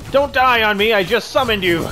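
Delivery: loud, high cry to an ally; urgent shout. Real, not acted. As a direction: call out urgently over the fight. Calling out to ally mid-fight.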